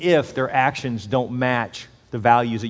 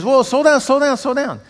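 dad calling out; 12 semitones higher, 7 dB louder than usual. Retold or imitated. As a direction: call after your boy, much higher. Dad calling after running son.

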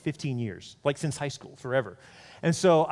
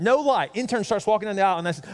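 ordinary talk

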